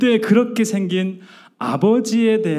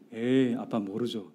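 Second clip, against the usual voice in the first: dismissive; 4 semitones lower, 7 dB quieter, slower than usual. Retold or imitated. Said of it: "딱 무시를 하는 거예요".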